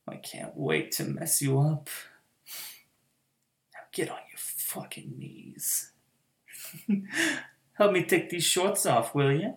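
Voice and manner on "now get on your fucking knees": soft whispered order; whispered command. Acted. Mistress ordering her pet down, whispered. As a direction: breathe the order right into his ear.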